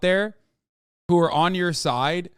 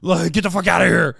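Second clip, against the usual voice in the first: loud mocking tough-guy yell; imitated macho outburst; mock angry threat; 7 dB louder than usual. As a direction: bark it like a fake macho. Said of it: "Every time they go like".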